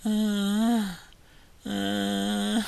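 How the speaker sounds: long, high-pitched droning moan; mock dazed "uhhh"; imitated moan. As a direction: moan it out on one held high note, imitating him. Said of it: "he just started going".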